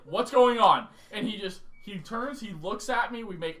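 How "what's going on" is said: loud, higher startled call; alarmed shout. Retold or imitated. Waking to roommate screaming, alarmed loud call. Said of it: "I'm like".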